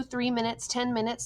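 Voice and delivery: ordinary delivery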